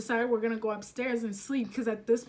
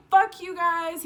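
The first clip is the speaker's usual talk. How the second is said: furious outburst, higher and louder; angry yell; 5 semitones higher, 6 dB louder than usual. Retold or imitated. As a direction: yell it in a rage. Guest raging at hosts.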